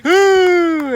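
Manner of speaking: high, loud ooh of excitement; excited gasp. Acted. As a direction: gasp like the crowd. Near fall in the match.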